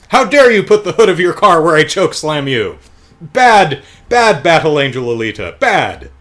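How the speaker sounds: loud, high, hammed-up indignation; mock scolding. Producer blaming the woman he attacked, mock scolding. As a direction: scold like the wronged party, full of bluster.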